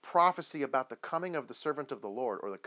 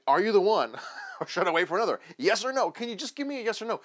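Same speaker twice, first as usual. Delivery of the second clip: higher, louder, impatient plea for a straight answer; exasperated demand; 6 semitones higher, 8 dB louder, faster than usual. Acted. John's disciples pressing Jesus for a yes or no, exasperated.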